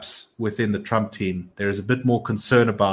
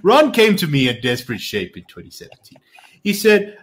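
emphatic, higher-pitched politician impression; Donald Trump; 6 semitones higher, 4 dB louder than usual. Reading Trump's tale of Ron to a co-host, mock-Trump voice. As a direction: launch the story in a showy Trump accent. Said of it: "do it in my Trump accent".

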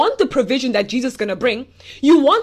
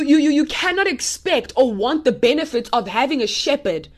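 ordinary talk

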